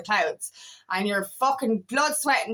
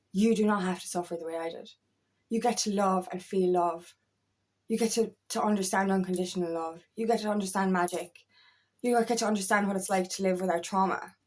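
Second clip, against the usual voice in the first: put-on tender to a child; 5 semitones lower, 5 dB quieter than usual. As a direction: soothe a small child who never has to suffer. Speaking to imagined future child.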